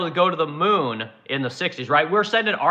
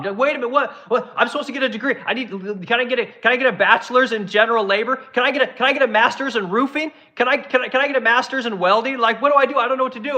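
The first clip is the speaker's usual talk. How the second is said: higher, whiny panicked office worker; mock panic; 7 semitones higher than usual. Laid-off office workers begging to be sent to trade school, high whiny panic. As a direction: flail like a lost office worker.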